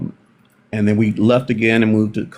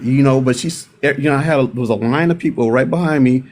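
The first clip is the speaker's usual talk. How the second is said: normal talking voice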